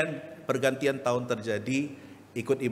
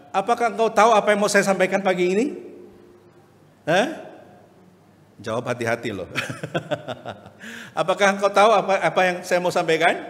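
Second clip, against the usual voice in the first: higher, louder, fast questioning of the crowd; loud challenge; 6 semitones higher, 6 dB louder than usual. Acted. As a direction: throw the question at the congregation. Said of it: "preacher quizzes congregation now". (pengkhotbah menantang jemaat dengan suara tinggi, keras dan cepat)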